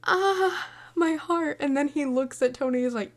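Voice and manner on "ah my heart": high, hammed-up cry of heartache; mock heartbreak. Reacting to Riff's stabbing with mock heartbreak. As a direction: clutch your heart in play. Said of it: "I was like".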